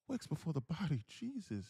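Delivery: high put-on voice for a viewer's comment; switched-up comment voice. Shown as a demonstration. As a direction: pitch it up to mark someone else's words. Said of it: "I gotta switch up the voice".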